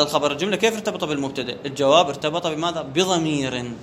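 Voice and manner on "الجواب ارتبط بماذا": normal talking voice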